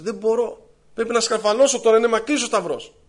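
high-pitched whining excuse; acted crying complaint. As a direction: plead helplessly with the angel. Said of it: "Τι φωνάζεις πάλι".

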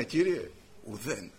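low, slow, subdued admission; a touch of empty-handed resignation; dejected reply. Acted. Fishermen admit they have nothing.

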